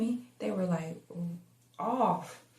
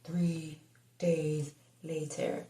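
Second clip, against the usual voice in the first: slow, low spooky narrator; creepy voice; 3 semitones lower, much slower than usual. Shown as a demonstration. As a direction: draw it out like a horror trailer. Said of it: "in my creepy voice".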